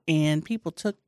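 ordinary talk, nothing marked

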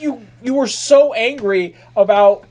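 exasperated. Retold or imitated. Retelling his complaint to sleep-yelling girlfriend, mock exasperated outburst. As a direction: throw it at her, fed up. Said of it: "I was like".

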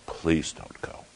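breathless, whispered plea; whispered begging. Retold or imitated. Charlie begging his friend to stay, whispered. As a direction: beg softly, almost no voice. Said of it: "he begged them".

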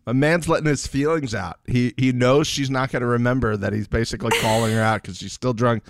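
normal talking voice

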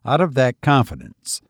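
plain reading voice

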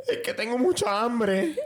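high laughing whimper; whiny crying plea. Retold or imitated. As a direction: imitate a weepy hungry wife. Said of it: "¿Qué te pasa?". (gimoteo agudo entre risas)

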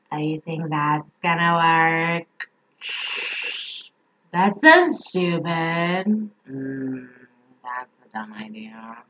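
put-on low, slow, raspy old smoker voice, soft; chain-smoking old lady critic. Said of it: "And this is how she sounds".

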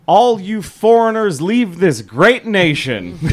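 rousing slogan. Retold or imitated. DJ feeding the caller one last thing, proclaiming it. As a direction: declaim it like a rally cry.

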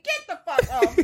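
half-shouted fake outrage; mock yell. Acting out club annoyance.

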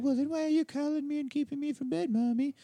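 put-on high complaining child; kid voice. Son whining at mom on phone, high child voice. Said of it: "this is your mother".

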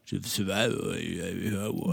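very quiet, low Rambo impression; Stallone. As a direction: mumble it low and soft, slurred. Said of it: "I can only do it really quietly".

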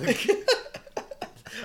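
high-pitched burst of laughter; helpless high laugh; real laugh. Real, not acted. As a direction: crack up at your own story. Laughs at own story.

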